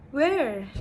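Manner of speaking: emphatic "where" with falling pitch; falling wh-question intonation. Shown as a demonstration. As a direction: hit "where" firmly, then let it fall. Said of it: "a falling intonation for w questions".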